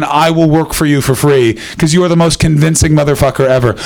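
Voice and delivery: ordinary talk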